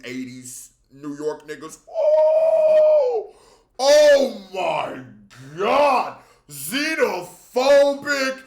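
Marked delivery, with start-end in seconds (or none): 1.9-8.4 loud, high exasperated cry of oh my God; outraged outburst. Real, not acted. Streamer yelling at the clip over xenophobic rappers, exasperated shout. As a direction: throw your hands up and yell at the screen.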